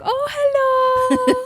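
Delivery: put-on high, drawn-out Filipino-accented greeting; singsong Filipino accent, delighted welcome, stretched long. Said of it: "I turn on an accent".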